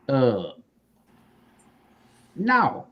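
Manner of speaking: ordinary talk, nothing marked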